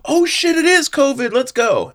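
gasping, high, loud mock amazement; excited recognition. Crowd realizing it is covid, excited mock shout. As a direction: burst out in hammed-up excitement.

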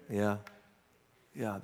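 ordinary talk, nothing marked

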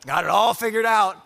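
a sudden high, loud jab of sarcasm; mock bravado. Preacher mocking his seventeen-year-old self, sarcastic and loud.

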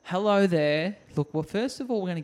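lightly hammed-up call; mock shout. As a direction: call it through the window. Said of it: "the lady was shouting in the window going".

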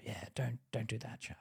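quiet gentle caution; soft warning. Retold or imitated. Kind boss softly warning an employee. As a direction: hush it kindly. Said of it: "My boss was nice".

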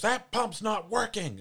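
high, slow, loud mock attendant yell; hammed-up high shout of a cranky gas station worker. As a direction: bark it out high and drawn out like a grumpy attendant. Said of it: "yells at me".

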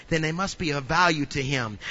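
normal talking voice

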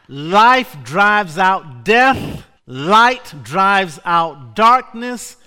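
loud, slow, drawn-out proclamation; full pulpit fervour; preaching with force. Acted. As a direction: build the sermon, hammering each phrase. Preacher building intensity.

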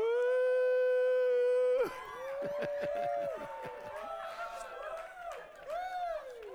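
long, very high ecstatic laugh; holy laughter. Real, not acted. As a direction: let the joy burst out in a laugh. Holy laughter erupting mid-sermon.

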